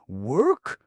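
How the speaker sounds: high, loud, shrill shriek. A narrator's reading. Queen told to work, shrill outraged squeal. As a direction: shriek the word in disbelief. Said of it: "shrilled Scraps".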